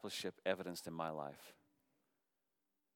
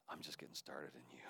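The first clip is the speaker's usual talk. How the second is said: much lower, much softer words; 7 semitones lower, 14 dB quieter than usual. Acted. Pastor voicing the Lord, hushed and low. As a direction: drop right down to a hush.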